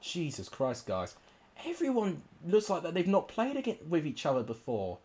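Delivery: usual speaking voice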